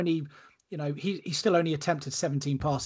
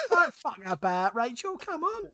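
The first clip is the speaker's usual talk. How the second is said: Grant Mitchell impression; 9 semitones higher than usual. Co-host mocks him demanding a drink, hammed-up Cockney hard man. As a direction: ham up a bad EastEnders geezer voice in Cockney. Keeps mocking the drink request.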